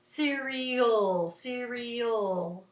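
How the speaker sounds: short start, lingering '-al' in 'cereal'; long last syllable. Shown as a demonstration. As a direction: draw out the end of 'cereal'. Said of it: "the old part seems a little longer".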